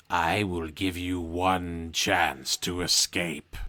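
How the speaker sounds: slow, measured sorcerer's voice; drawn-out, weighty declaration; declaring wizard. A narrator's reading. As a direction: proclaim it slowly and solemnly. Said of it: "he declares".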